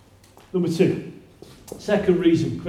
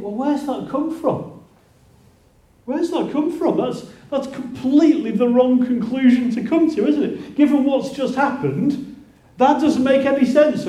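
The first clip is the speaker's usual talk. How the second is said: higher, baffled disbelief; can't believe what he just said; incredulous; 7 semitones higher than usual. Retold or imitated. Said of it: "and you think".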